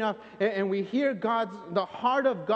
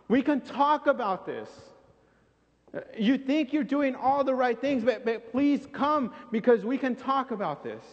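pleading higher and higher, drawn out; God begging on his knees; 4 semitones higher than usual. Acted. Preacher voices God pleading on his knees with rebellious people. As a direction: beg them to come, voice rising. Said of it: "God on his knees begging".

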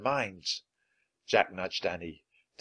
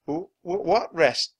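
frightened stammer; 8 semitones higher, 4 dB louder than usual. Acted.

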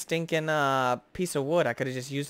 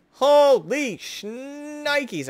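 slow, high, loud drawn-out amazement; stunned exclaim; 12 semitones higher, 8 dB louder, much slower than usual. Real, not acted. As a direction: stretch it out, blown away. Reacts to the count.

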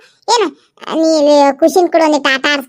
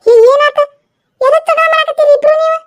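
high, loud startled call; loud, high cry of what's going on; alarmed mother-in-law; 10 semitones higher, 3 dB louder than usual. Acted. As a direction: burst in to stop the fight. Mother-in-law breaks up fight.

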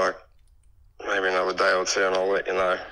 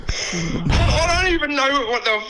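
incredulous; 11 semitones higher than usual. Real, not acted. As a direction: stretch it out, stunned. Exasperated at a stranger's offer.

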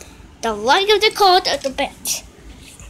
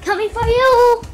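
higher, louder rescue shout; mock heroic call; 6 semitones higher, 4 dB louder than usual. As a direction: call out like an arriving hero. Hero rushes to rescue.

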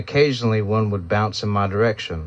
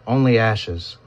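ordinary delivery

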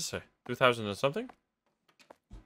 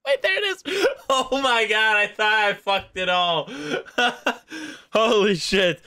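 relieved high laughter; laughing off fear; 7 semitones higher, 4 dB louder than usual. Real, not acted. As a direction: laugh out the scare. Relieved after near loss.

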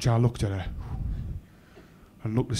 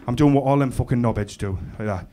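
normal talking voice

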